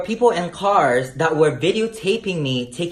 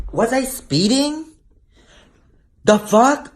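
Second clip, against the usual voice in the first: indignant disbelief; 8 semitones higher, 4 dB louder, slower than usual. Real, not acted. Indignant rhetorical questions.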